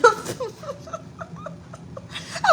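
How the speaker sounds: squealing laugh close to crying; high laugh-cry. Real, not acted. Mom hearing her son moved out, high teary laugh.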